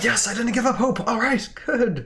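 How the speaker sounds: high, thrilled fans cheering; relieved cheer. Retold or imitated. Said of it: "Everybody's like".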